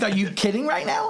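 high incredulous retold question; mock stunned reply; disbelief. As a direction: throw it back in disbelief. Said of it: "and I'm like".

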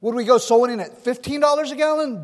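usual speaking voice

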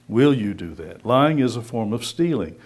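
ordinary talk

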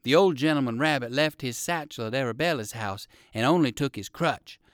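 ordinary delivery

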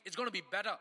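ordinary talk, nothing marked

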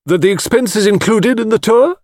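imperious, put-on matron voice; deep-voiced matron. A narrator's reading. Mrs Caldecott querying the tea expense, imperious. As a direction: demand an answer with great dignity. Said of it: "in her deep voice".

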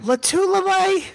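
high-pitched, disapproving announcer. Acted. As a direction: say the name as if he's doing a bad job. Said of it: "make you think he disapproves of Lowell".

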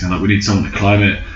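normal talking voice

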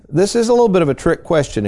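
normal talking voice

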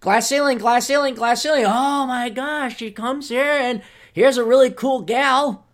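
a hint of a preachy professor, drilling slogans; mocking professor voice. Scene: voicing McGill professors drilling slogans into her, mock preachy. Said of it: "McGill University told her".

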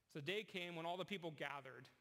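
ordinary talk

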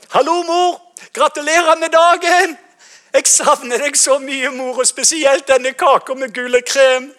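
loud, high Norwegian greeting to mom; Norwegian phone call. Retold or imitated. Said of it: "let me translate for you then".

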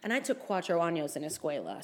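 ordinary delivery

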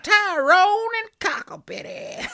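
hammed-up American accent. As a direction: draw out the letter's ending in a broad American accent. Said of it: "it's appropriate to do the accent".

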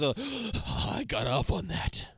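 gasping retold excitement; gasp, then act out a thrilled confession; play-acted excited outburst; mock excitement. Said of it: "he gets real excited".

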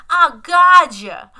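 put-on loud, high, drawn-out New York kid; loud New York accent. Mocking the child actor's line, loud New York accent. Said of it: "he talks too loud. He has this, like, weird accent. It's, like, New York".

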